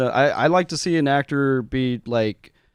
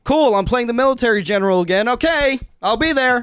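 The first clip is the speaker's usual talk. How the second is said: higher, louder, fast sarcastic patter; mock bored actor; 9 semitones higher, 3 dB louder than usual. Actor complaining about typecasting, fast mock sarcasm. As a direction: rattle it off like a jaded typecast actor. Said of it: "it's just like".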